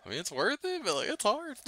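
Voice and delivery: high-pitched, breaking into a laugh; amused admission. Real, not acted. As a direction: confess it while tipping into laughter. Joking admission about married life, then laughing.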